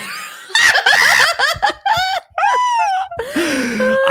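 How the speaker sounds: shrieking laugh; real explosive laugh, loud and high; loud burst of laughter. Real, not acted.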